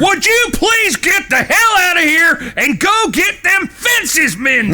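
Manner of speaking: shouting the brothers back to work; angry shout. Acted. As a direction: lose your temper and bellow at them. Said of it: "You don't need to shout".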